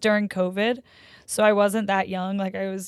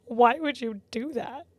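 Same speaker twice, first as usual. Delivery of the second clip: slightly higher, whimpering reproach; mock tearful upset; 3 semitones higher, 4 dB quieter than usual. Girlfriend to boyfriend who uprooted a plant, mock tearful. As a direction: plead as if close to crying.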